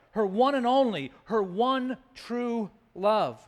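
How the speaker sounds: slow, measured lament in verse; dramatic poem reading. A narrator's reading. Telling children of Naomi's lost son, slow dramatic verse. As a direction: stretch each stressed word for the children.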